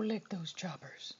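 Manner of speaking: soft whispered coaxing. Real, not acted. Owner coaxing her dog after a treat, whispered. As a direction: breathe it as a whisper.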